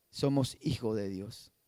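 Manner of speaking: quiet intimate affirmation to the congregation; hushed affirmation. Acted. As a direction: let the words sink in quietly. Repeated truth dropped to a hush.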